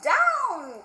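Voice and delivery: high loud cry of down; witch cries down. Acted. Witch shouts to her cat to land. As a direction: call it out like the witch diving. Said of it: "cried the witch".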